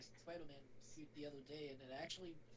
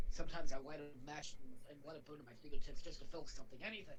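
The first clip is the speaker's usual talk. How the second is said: brooding movie quote; 10 dB louder than usual. Retold or imitated. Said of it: "My favorite line is when he's like".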